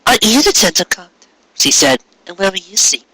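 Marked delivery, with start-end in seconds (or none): 0.1-1.1 higher mare's voice, playful; teasing Cupcake. Acted. 2.3-3.1 higher mare's voice, playful; teasing Cupcake. Acted.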